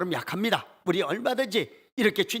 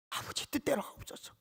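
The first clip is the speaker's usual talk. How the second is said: soft whisper, much quieter; whispered prayer; 8 semitones higher, 8 dB quieter than usual. Acted. Jesus praying to the Father in a hushed whisper.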